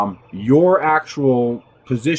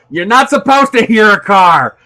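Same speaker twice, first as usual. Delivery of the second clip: loud, raised-pitch amused scolding; laughing exasperation; 6 semitones higher, 6 dB louder than usual. Real, not acted. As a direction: scold loudly, breaking into laughter at the end. Exasperated at careless pedestrians, laughing.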